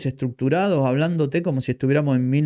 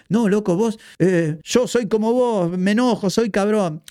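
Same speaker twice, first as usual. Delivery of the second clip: mock fan voice; 7 semitones higher than usual. Mocking listeners who imitate him.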